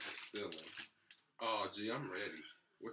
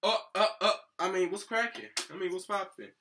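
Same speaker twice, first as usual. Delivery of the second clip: hammed-up greeting; 10 semitones higher, 12 dB louder than usual. Hamming up greeting to the recording.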